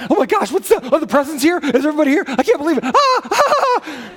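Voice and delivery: high giddy squeals with laughter; overexcited dog. Retold or imitated. Pastor voicing his boxer's Christmas glee, high and squealing. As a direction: burst with Christmas-morning joy. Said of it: "every day is Christmas".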